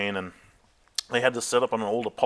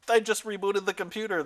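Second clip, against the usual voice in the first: defensive protest; 11 semitones higher than usual. Retold or imitated. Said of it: "And I go".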